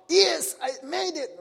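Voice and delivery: high, drawn-out shout of success; mock triumphant shout. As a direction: crow it out as if from the rooftop. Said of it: "shout out".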